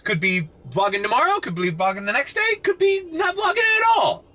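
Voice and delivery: high, rising mock suggestion climbing to a squeal; sarcastic. Vlogger re-enacting his own joke to viewers, sarcastic. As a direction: toss out absurd options sarcastically, pitch climbing each time. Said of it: "sarcastically, I might have said".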